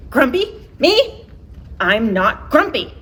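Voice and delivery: indignant denial. Acted. Jim indignantly denying he is grumpy. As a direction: bristle at the very idea.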